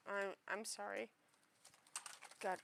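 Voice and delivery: normal talking voice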